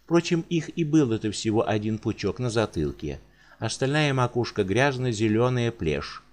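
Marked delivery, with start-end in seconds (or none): none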